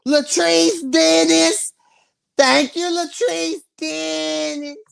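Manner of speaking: slow, high, sung-out name; drawn-out thank you. Real, not acted. Thanking a donor on live, slow and high.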